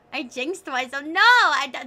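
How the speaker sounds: ordinary talk, nothing marked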